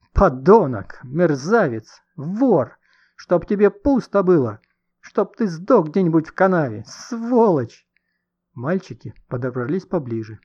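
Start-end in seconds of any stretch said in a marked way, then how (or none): 0.1-7.9 raised, high-pitched angry cursing; tearful yelling. A narrator's reading. Wronged woman cursing the man who hurt her, crying out. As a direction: hurl each insult in distress. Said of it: "рыдая, кричала".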